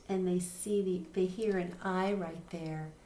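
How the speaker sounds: normal talking voice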